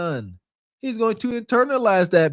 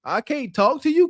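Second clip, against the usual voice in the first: put-on higher, whiny little-kid voice; imitating the kid; 7 semitones higher than usual. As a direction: play the son complaining to mom. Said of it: "that kid, if you ask me, was right".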